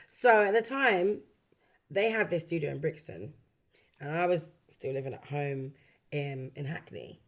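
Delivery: usual speaking voice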